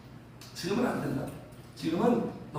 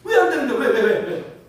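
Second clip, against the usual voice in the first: acted-out indignant badgering; argumentative protest; 5 dB louder than usual. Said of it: "항변하고 막 따지듯이". (따져 묻듯이)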